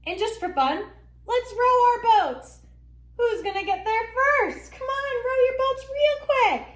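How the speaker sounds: high, loud, excited teacher cheer; kindergarten teacher voice. Real, not acted. Teacher urging kids to row boats.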